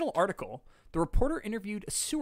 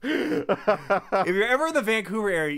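laughing; 5 semitones higher than usual. Real, not acted. Restarts line over laughter.

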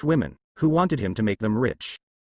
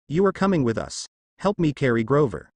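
ordinary delivery

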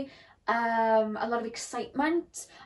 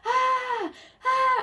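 high mock shriek of "ah, ah"; comic high-pitched scream, played for laughs; imitated scream; 14 semitones higher, 5 dB louder than usual. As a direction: shriek it like a girl bolting from the car. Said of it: "she's screaming".

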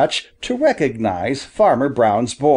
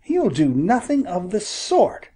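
put-on higher, firm boy's voice; defiant boy; 6 semitones higher than usual. A narrator's reading. Boy refusing to give the wounded bird to the hunter. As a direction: stand your ground, young and stubborn.

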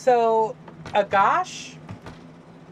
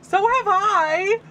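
high, slow sassy reply; mock indignant; 13 semitones higher, 5 dB louder, much slower than usual. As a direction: drag it out, all sassy.

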